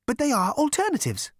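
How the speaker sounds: high-pitched machine admission; admitting elevator. A narrator's reading. Elevator admitting dull options to Zaphod, high voice. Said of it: "it admitted".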